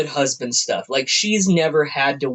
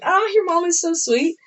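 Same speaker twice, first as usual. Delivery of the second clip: much higher, gushing outsider; high sweet voice; 12 semitones higher than usual. Retold or imitated. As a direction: gush it up high. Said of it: "you're like".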